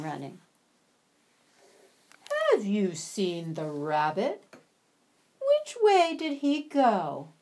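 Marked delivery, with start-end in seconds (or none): 2.5-7.3 sly fox, slightly rising and louder; lightly voiced fox. Fox questions the animals about the rabbit, a sly demand. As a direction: sniff around as a hunting fox.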